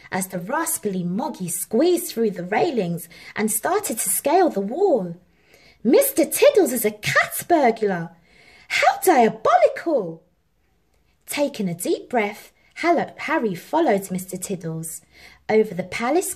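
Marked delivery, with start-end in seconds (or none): 5.8-10.2 put-on loud, outraged boy's discovery; shocked boy. A narrator's reading. Harry seeing the cat burglar, shocked. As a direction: gasp it out in horror.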